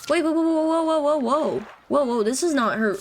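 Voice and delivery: real alarm, high rapid-fire string of whoas; startled. Real, not acted. Player spots that this is not her, startled and high. Startled by what the game shows.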